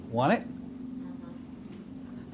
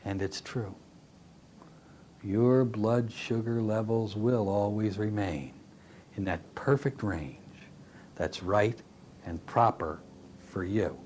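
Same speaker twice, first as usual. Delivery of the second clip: much lower, softer and slow; very quiet voice; 8 semitones lower, 6 dB quieter, slower than usual. Shown as a demonstration. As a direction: soothe them, slow and hushed. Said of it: "in a very quiet voice".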